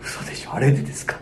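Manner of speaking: mock whisper of the startled girl; disbelieving half-whisper, barely voiced; hushed disbelief. Tokyo girl hearing the angry caller, hushed whisper of disbelief. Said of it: "先生はそう言いました".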